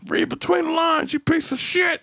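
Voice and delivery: put-on high-pitched old-man taunt; comic high-voiced insult; Bash. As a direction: imitate a cranky old driver, very high. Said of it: "He used to tell you all the time".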